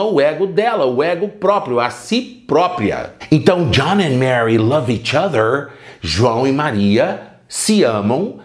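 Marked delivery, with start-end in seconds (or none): none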